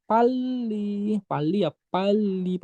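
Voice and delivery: "pali" with the l held long; slow, stretched model of "pali", doubled l; pronunciation demo. Shown as a demonstration. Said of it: "Kalau pali dibacanya ya".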